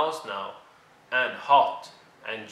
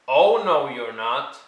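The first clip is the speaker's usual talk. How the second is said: loud refusal; 9 dB louder than usual. Acted. George refusing entry.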